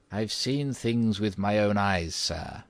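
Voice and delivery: lightly voiced old housekeeper, grave and ominous; a hint of mysterious old servant; stern warning. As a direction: warn the gentleman solemnly. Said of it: "she sternly warned Mister Otis".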